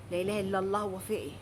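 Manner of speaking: exasperated sigh-prayer. Acted. Customer losing patience with the courier, soft and low.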